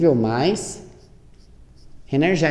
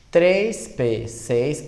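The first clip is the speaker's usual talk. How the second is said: ditando devagar; much slower than usual. Shown as a demonstration. Professora dita a distribuição.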